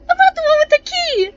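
high mock voice.

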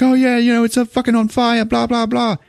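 put-on very high, agitated British accent. British executive phoning the police, high agitated British accent. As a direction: squawk it in mock-British panic. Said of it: "he's playing it British".

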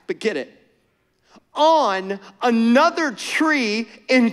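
rising, fervent preacher cadence; preaching. Acted. Pastor preaching to the church, fervent and building. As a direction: preach it to the congregation with fire.

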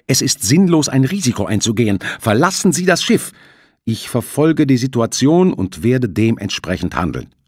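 high, loud, pressing plea; insistent. Acted. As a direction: press him to leave. Shipowner insists.

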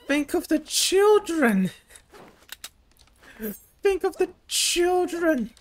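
high-pitched, hammed-up moral outcry; mock pleading. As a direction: plead melodramatically, building to a loud mock wail. Mock plea to spare bullets.

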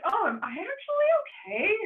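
high-pitched mock realization; retold worry. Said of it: "I was worried about myself".

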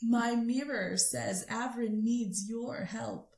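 slow, deliberate repeat. Shown as a demonstration. [name] repeating the message slowly after being asked what. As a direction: say it again slowly so she hears.

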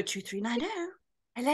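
soft, refined telephone answer; mum's posh phone voice. Acted. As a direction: answer the phone putting on poshness. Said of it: "if she picked the phone up".

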